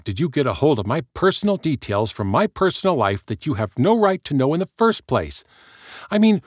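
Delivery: rising outrage. Acted. Heather raging at the fan who knows her secrets, outraged. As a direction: let the panic spill into fury.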